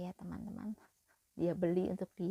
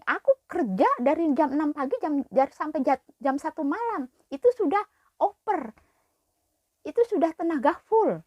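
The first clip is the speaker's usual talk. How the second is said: much higher, louder indignant outburst; angry retort; 9 semitones higher, 11 dB louder than usual. Retold or imitated. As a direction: throw the hours back at her. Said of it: "Aku berani". (membalas dengan marah dan lantang)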